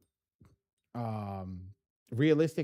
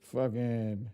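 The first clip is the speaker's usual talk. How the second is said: ordinary delivery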